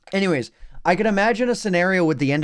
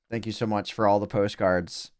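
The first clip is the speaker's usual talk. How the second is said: monotone; 6 semitones lower, 6 dB quieter than usual. Real, not acted. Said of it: "the most monotone voice imaginable".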